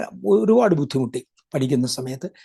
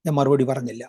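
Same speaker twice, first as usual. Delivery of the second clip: normal talking voice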